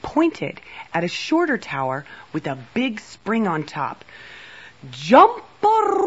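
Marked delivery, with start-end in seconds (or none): 4.9-6.1 very high, drawn-out whoop; whooping cry. A narrator's reading. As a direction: launch the jump with a long loud call. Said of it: "cried the Moon Queen".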